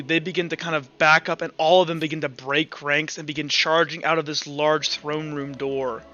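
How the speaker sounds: usual speaking voice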